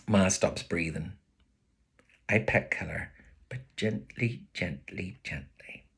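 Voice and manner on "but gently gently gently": softer with each word, down to a whisper; gentle. A narrator's reading. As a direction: fade away as if stroking a pet. Petting the dog gently.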